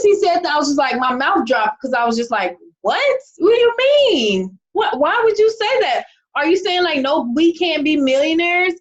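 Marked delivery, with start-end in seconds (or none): 2.8-8.7 high-pitched, indignant retelling of shock; shocked disbelief. Retold or imitated. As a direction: act out jaw-dropped disbelief, pitch leaping up. Acts out shock at brother's reply.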